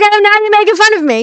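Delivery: high, loud, quick imitation of someone mad; worked-up, put-on complaint; mock indignation. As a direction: imitate an offended friend protesting. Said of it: "He was so mad".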